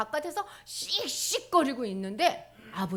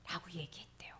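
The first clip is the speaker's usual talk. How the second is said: hushed soft closing line; storyteller whispering to the children; 5 semitones lower, 12 dB quieter than usual. A narrator's reading. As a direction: whisper it like a secret.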